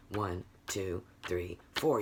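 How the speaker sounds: low, even "One, two, three, four"; counting on the beat. Shown as a demonstration. Teacher showing quarter notes, evenly spaced count. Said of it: "the accent is on the beat".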